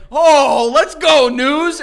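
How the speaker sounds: high, loud re-enacted greeting; rousing shout, acted out; excited cheer. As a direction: hype him up like a fan. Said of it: "look at him and say".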